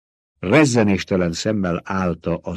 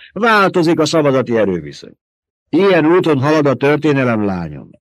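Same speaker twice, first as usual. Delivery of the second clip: higher, louder stern lecture; lecturing old mother superior; 6 semitones higher, 5 dB louder than usual. Acted. Old woman lecturing Jessica on the species. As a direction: hammer the lesson into her.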